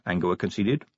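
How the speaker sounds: ordinary delivery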